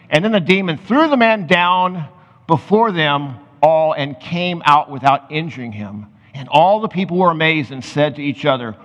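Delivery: ordinary talk, nothing marked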